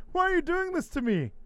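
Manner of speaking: high whimpering plea; whiny Bray. Retold or imitated. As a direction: whine it like a hurt puppet host. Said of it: "Bray's like".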